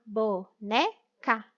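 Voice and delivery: "Boneca" slowly split as bo, ne, ca; syllable by syllable. Shown as a demonstration. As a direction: sound out "Boneca" with a gap after each syllable. Joins syllables to form the word.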